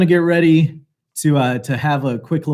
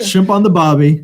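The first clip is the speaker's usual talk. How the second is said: put-on Australian accent; 5 dB louder than usual.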